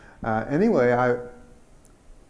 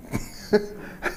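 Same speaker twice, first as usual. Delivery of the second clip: short laugh. Real, not acted. Laugh after punchline.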